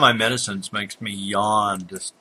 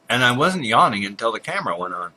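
usual speaking voice

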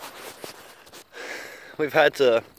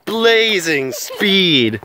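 very slow dramatic drawn-out words; dramatic slow-motion; 3 semitones higher, 4 dB louder, much slower than usual. Pretend. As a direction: stretch every word out in slow motion. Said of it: "Get it?".

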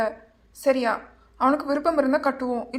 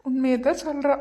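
laughing. Acted. Laughing at wife's teasing.

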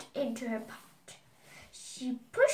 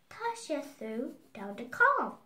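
usual speaking voice

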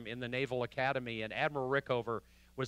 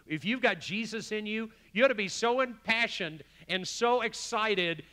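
high, fervent sermon build; impassioned preaching; 7 semitones higher, 5 dB louder than usual. Real, not acted. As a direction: pour it out with passion, pitched up. Preaches at full force.

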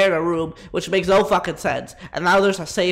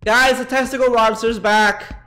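raised, higher announcement; calling out; 4 semitones higher, 4 dB louder than usual. Acted. Streamer warns chat the monster is back, calling out. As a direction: sound the alarm to the chat.

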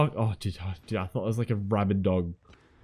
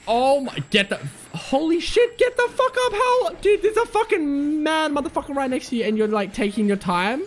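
panicked scream; 12 semitones higher than usual. Real, not acted. Player screaming at a teammate beside a monster, high panicked yell. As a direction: shriek at your friend to move.

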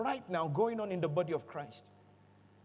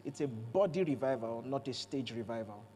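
lower, softer, grave declaration; solemn low; 9 semitones lower, 6 dB quieter than usual. Real, not acted. Preacher solemnly contrasting body and stage revival. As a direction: say it low and weighty. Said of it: "Mark my words".

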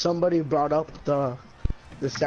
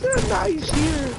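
much higher, slow squeak; high squeaky voice; 4 dB louder, slower than usual. Pretend. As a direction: squeak it out slowly.

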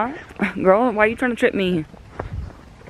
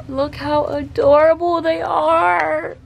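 high, slow, teary coo over puppies; 8 semitones higher, slower than usual. Real, not acted. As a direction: gush at the puppies.

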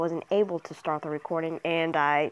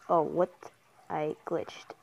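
ordinary talk

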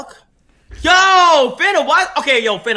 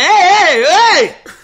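loud, raised retold hollers; high, insistent acted shout; calling out; 6 semitones higher, 9 dB louder, much slower than usual. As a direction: call out to get someone's attention. Retelling calling out to someone live.

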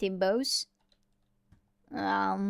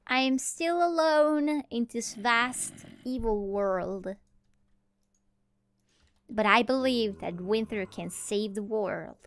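slow, drawn-out heroic monologue; mock-epic narration; slower than usual. Lone player hero in a vast evil world, mock-epic. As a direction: stretch it out like a solemn saga.

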